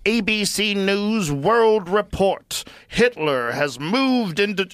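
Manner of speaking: slow, high, loud newsreel voice; old-timey newsreader. Retold or imitated. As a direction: announce it like a 1940s newsreel. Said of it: "everyone talks in a way that's not natural".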